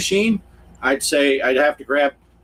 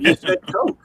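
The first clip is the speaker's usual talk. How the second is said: mock disbelief. Retold baffled reply to Southern waiter.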